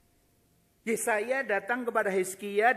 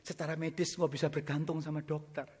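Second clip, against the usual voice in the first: ordinary delivery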